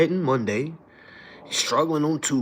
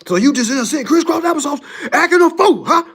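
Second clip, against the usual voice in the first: loud, high scolding dad threat; angry father; 12 semitones higher, 8 dB louder, faster than usual. Retold or imitated. Father scolding his misbehaving son, loud threat. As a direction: bark it like a dad about to punish. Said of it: "He said".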